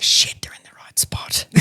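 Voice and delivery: unvoiced whisper through laughter; hushed so the guests can't hear. Real, not acted. Guide whispering to herself on tour, unvoiced.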